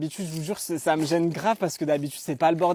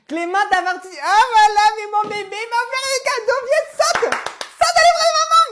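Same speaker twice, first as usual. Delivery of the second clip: put-on very high, loud baby-talk gushing; gushing doting mother; 9 dB louder than usual. La mère s'extasie devant son fils chéri, voix de bébé suraiguë. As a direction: dote over her little boy.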